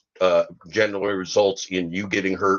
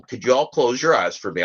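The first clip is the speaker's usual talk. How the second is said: normal talking voice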